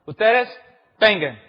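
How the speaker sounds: loud, barked order of a Roman soldier; commanding shout. Acted. Soldier ordering Christians forward, loud command. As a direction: call them forward like a soldier.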